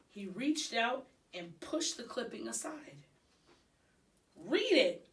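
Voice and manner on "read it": loud, high hamster squeak. A narrator's reading. Hamster squeaking an urgent order at Aldo. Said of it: "I squeaked".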